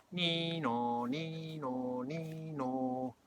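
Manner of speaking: two-tone nee-naw, long held notes; imitated ambulance siren. A narrator's reading. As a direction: wail it like a siren.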